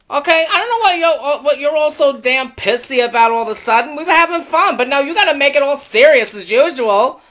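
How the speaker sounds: fast, heated telling-off; worked-up, indignant rant; angry scolding. Acted. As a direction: tear into them, threatening. Lulu scolds and threatens Richie.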